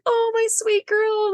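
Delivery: tender coo. Retold or imitated. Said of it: "I'm like".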